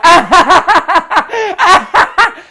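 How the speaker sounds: very loud, high-pitched cackling laughter; crazy mocking laugh. Gang friends laughing at an aggressive guy, crazy style. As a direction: imitate wild laughter in someone's face. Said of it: "they just point and laugh, like, crazy style".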